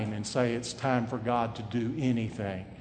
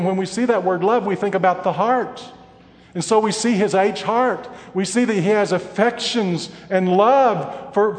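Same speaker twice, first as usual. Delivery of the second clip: loud, high preacher's cry; building sermon thunder; fiery preaching; 7 semitones higher, 10 dB louder than usual. Acted. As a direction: press the rebuke home from the pulpit. Preaching at full force.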